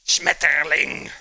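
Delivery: "Schmetterling" loud, slow and harsh in German. Shown as a demonstration. Streamer joking German makes butterfly sound like a disease, harsh. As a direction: hammer out "Schmetterling" like a curse. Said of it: "In German".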